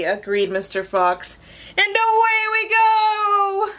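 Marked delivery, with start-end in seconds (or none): 1.8-3.7 high, long drawn-out cheer; joyful send-off. Acted. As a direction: sing it out slowly as you lift off. Takeoff refrain.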